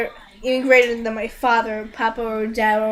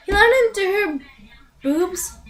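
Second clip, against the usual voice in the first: high-pitched, scandalized disbelief; incredulous; 7 semitones higher than usual. Retold or imitated. Reviewer balking at a crude bathtub scene, incredulous. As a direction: repeat it as if you can't believe it.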